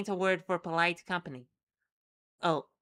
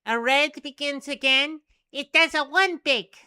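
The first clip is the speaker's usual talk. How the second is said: high, loud squeaky creature chatter; 7 semitones higher, 10 dB louder than usual. Acted. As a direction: chirp it out like a tiny critter. Creature chirps in a strange voice.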